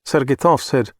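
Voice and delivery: ordinary delivery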